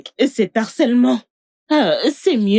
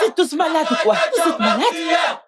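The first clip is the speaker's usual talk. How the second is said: high-pitched outraged cry, dragged out at the end; indignation criée; 6 semitones higher than usual. Acted. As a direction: shout back at the mob, exasperated. Accusée excédée face à la foule. (cri indigné et aigu)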